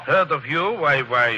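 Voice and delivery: startled count in a put-on European accent; fake European accent. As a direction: keep the phony European accent while rattled. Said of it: "forget your accent".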